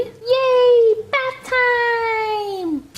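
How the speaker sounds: a pup thrilled about bath time; excited cheer. Acted. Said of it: "you're super excited for bath time".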